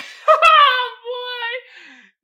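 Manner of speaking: high, loud laugh into tears; crying laugh. Real, not acted. Host crying with laughter after the reread. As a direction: laugh until it turns to a cry. Cracking up again.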